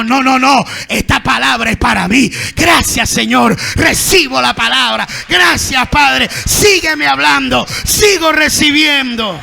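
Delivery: loud, fervent "esta palabra es para mí"; a humble believer shouting thanks; joyful receiving. Acted. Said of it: "Pero nunca dice".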